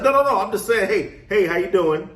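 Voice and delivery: high, loud, overbright backpedaling; hammed-up keen salesman calming a startled stranger; pushy eager reassurance. To strangers backing away, high and overeager. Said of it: "I'm coming at them with this energy".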